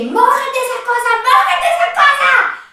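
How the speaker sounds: high, mounting yelled command; reenacted panicked shout. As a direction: shriek the order in acted panic. Retells shouting panicked orders. (orden gritada con pánico actuado)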